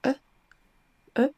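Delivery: "E" as a soft, neutral vowel; short relaxed "E", isolated; soft schwa. Shown as a demonstration. Teacher shows how schwa sounds, soft neutral vowel. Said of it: "c'est un son E assez doux".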